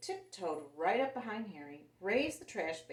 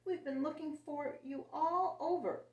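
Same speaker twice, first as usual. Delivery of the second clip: put-on high, excited child voices; children crying out; 8 semitones higher than usual. A narrator's reading. Children finding their lost dog, high and excited. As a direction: call out in relief. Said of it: "they cried".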